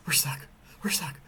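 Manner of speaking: mock panic building to a loud, high cry; imitated panic. Daughter realizing the van is stuck, imitated panic building. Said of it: "she starts panicking".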